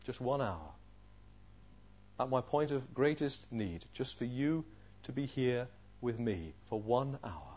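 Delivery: soft, low, wounded appeal; hushed, sorrowful disappointment; hurt reproach. Acted. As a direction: plead quietly with a friend who failed you. Jesus' hurt reproach voiced softly.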